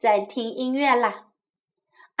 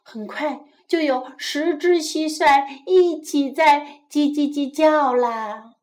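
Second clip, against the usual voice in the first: ordinary delivery, nothing marked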